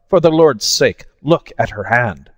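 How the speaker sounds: urgent plea, voice jumping up at Lord's; cried out in alarm. A narrator's reading. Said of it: "he cried".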